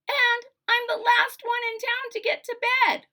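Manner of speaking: put-on high, loud girl's protest; protesting daughter. Daughter protesting to her father, high and loud. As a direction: push back, exasperated.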